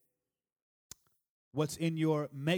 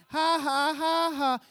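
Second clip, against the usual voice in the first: mocking laugh; 14 semitones higher, 8 dB louder, slower than usual. Mocking the past.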